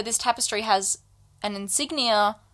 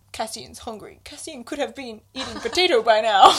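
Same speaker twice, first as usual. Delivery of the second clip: lightly hammed-up hungry child, building in volume; childish Cassian; 3 dB louder than usual. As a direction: start small, then complain like a kid. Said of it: "Cassian is a child".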